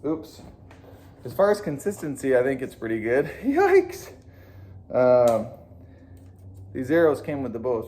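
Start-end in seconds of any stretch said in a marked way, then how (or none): 3.4-4.1 high-pitched laughing yikes; surprised squeak through a laugh; startled yikes. Real, not acted. Arrow shot goes astray.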